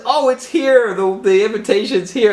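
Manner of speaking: very high, delighted squeal; gleeful Joker. Retold or imitated. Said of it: "he says".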